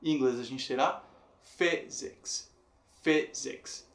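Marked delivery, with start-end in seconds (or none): 1.4-3.9 "physics" slowly split, strong on "phy"; stress on first syllable. Shown as a demonstration. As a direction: stress the start of "physics", pause, finish it. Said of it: "Fi também sendo a forte". ("physics" separado, com a primeira sílaba forte)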